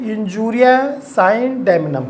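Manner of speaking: each Latin word spaced out very slowly for notes; slow dictation. Shown as a demonstration. Teacher slowly dictating the first maxim to students. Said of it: "पहला maxim क्या है?".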